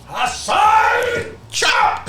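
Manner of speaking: very high, slow shouted war cry; battle cry. Retold or imitated. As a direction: bellow the cartoon battle cry. Shouting cartoon catchphrase.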